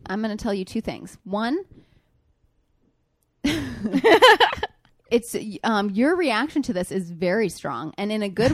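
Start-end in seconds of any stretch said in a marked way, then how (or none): 3.4-4.7 burst of laughter. Real, not acted. Laughing before making a point.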